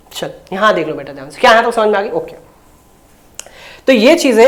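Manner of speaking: ordinary delivery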